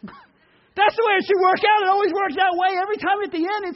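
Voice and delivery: high, loud laughing burst; laughing excitement. Real, not acted. Preacher laughing at happy movie endings, loud and high.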